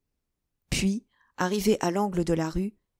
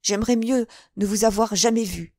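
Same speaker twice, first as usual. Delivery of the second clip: higher, louder impulsive cry; naive blunt outcry; 5 semitones higher, 5 dB louder than usual. A narrator's reading. D'Artagnan crying out to Madame Bonacieux, naively brutal. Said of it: "s'écria D'Artagnan avec cette brutalité naïve".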